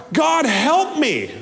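high, slow plea; preacher crying out to God. Acted. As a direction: call to God for help. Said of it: "cry out".

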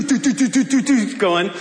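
playful heartbeat imitation; heartbeat rhythm. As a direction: tap out the skipping heartbeat with your voice.

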